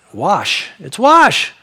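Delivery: "wash" with an open ah and no R; speaker's own pronunciation. Shown as a demonstration. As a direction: insist on "wash", vowel straight into sh. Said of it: "That's how I've always pronounced it".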